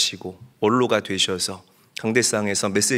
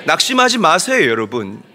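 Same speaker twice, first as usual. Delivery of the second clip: high, louder, impassioned sermon climax; fervent preaching; 8 semitones higher, 8 dB louder than usual. Acted. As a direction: drive the promise home to the congregation. Preaching at full force. (열정적으로 외치는 설교)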